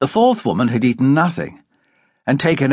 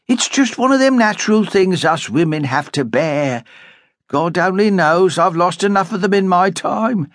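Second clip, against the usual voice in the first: lightly voiced kindly matron; 9 semitones higher than usual. A narrator's reading. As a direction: comfort her like a daughter. Older woman consoling Amy.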